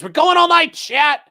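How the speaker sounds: high, loud mock cheer; big hammed-up holler; hyped-up shout. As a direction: yell it out high to the whole crowd. Acting out a member's excited message.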